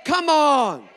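loud, high shouted call; loud shouted call to the church; preacher's shout. Acted. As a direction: rouse the congregation.

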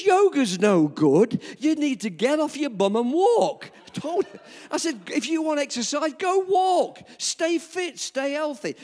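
loud, high, hearty telling-off with a laugh; emphatic advice. Retold or imitated. Old pastor loudly telling the nurse to get walking. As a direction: bellow it like a cheerful coach.

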